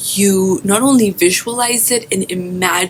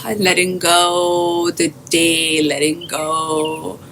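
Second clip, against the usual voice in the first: guided meditation. Acted. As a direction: guide listeners gently into their breath, drawing out phrase endings.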